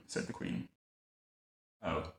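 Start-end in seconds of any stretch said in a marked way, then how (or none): 1.8-2.1 low, soft, deflated murmur; disappointed. A narrator's reading. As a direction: let it drop, quietly let down.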